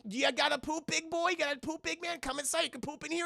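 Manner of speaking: put-on high-pitched toddler coaxing; singsong high mock-parent voice. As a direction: talk a little one inside. Coaxing a child indoors, acted.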